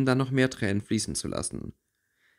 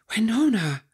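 viel höher und lauter gerufen; entsetzter Ausruf; 11 semitones higher, 4 dB louder than usual. Acted. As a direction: erschrocken den Namen rufen. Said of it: "rief sie entsetzt". (Theresa ruft entsetzt nach ihrer Freundin, hoch und laut)

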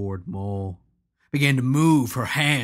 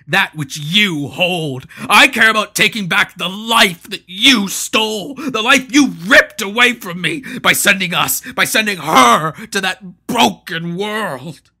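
louder and higher, that which you hold slowed; grieving rage; 10 semitones higher, 12 dB louder than usual. Acted. Maul raging at Hanno over his stolen life, louder and higher. Maul's grief-fueled rage.

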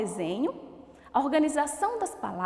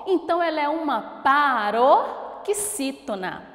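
ordinary talk

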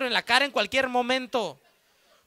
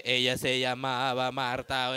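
sulky muttered line; 11 semitones lower, 4 dB quieter, slower than usual. Retold or imitated. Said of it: "de culero así de".

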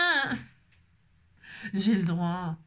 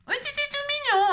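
much higher, squealing over something cute; squeaky cute voice. Pretend. Gushing to viewers about the little labels, high squeal. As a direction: squeal like it's adorable.

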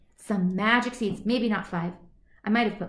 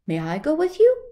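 higher, louder eager dog; 6 semitones higher, 7 dB louder, faster than usual. Acted. Dog asks to join.

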